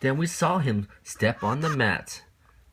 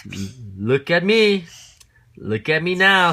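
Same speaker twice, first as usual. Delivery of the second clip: loud, high boastful call; showing off; 7 semitones higher, 7 dB louder than usual. Acted. Cat shows off to the children, loud boast. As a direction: crow for attention like a show-off. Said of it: "said the cat".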